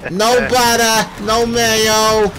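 high, slow put-on ordering voice; drive-thru order voice. Shown as a demonstration. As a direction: draw out the order in a silly high voice. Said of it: "if I go through a drive-thru, I'm like".

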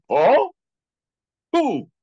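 sudden high, loud questioning oh; surprised. Retold or imitated. Lama hearing an unexpected teacher's name, surprised and loud. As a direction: perk up in surprise.

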